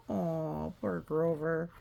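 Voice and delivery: ordinary delivery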